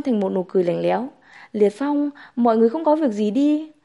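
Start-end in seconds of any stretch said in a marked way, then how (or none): none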